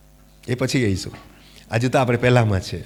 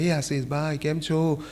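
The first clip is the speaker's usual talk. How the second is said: much softer and slow, a gentle hello; soft call in silence; 8 dB quieter than usual. Retold or imitated. Someone greets Ashish softly in pin-drop silence, slow gentle call. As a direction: call his name gently into a silent room. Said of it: "કોઈ મને જરા અવાજ કરે".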